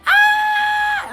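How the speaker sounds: very high, very loud mock shriek of joy; imitated shrill scream. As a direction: shriek like two people screaming on the phone. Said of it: "they start screaming like".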